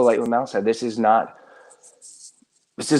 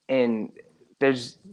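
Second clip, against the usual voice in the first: ordinary talk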